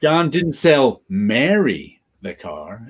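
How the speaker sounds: "Mary" lifted and stretched, pause after it; slow read with emphatic "Mary"; stress on MARY. Shown as a demonstration. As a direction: hit "Mary" as the key word. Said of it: "you put the emphasis on each".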